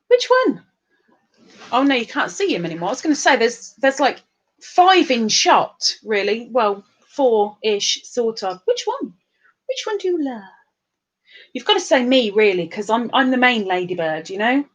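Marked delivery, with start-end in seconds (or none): 0.1-0.6 very high-pitched teasing question; jokey high-voiced asking; playful high squeak. 8.6-10.4 high, cutesy pleading question; small high teasing voice; playful high voice. Pretend.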